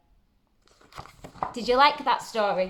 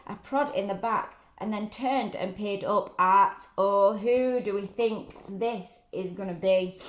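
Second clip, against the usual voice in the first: ordinary delivery, nothing marked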